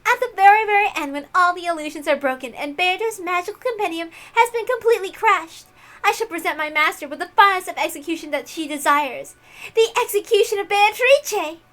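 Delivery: high, loud and thrilled at the gruesome plan; high, loud fawning young witch; excited servant girl. Acted. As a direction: gloat with eager glee for your master. Erica promises the grand finale.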